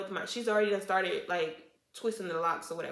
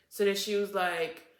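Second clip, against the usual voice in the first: ordinary talk, nothing marked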